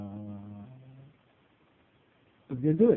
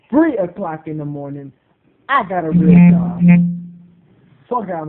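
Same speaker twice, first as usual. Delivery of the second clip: louder, emphatic I got a real job; indignant louder comeback; loud retort; 11 dB louder than usual. Acted. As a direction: shout it back with attitude. Angry retort in rant.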